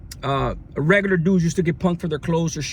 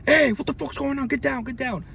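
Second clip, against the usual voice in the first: higher mock command bark; imitated deputy yelling; 7 semitones higher than usual. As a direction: play the deputy storming in to break it up. Deputies burst in yelling orders.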